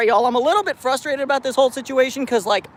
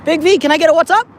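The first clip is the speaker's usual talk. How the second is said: loud, fast, high playful call; loud playful pet call; 7 semitones higher, 6 dB louder, faster than usual. Real, not acted. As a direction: coax the cat to answer, high. Calling cat by name.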